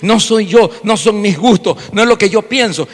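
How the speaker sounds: ordinary talk, nothing marked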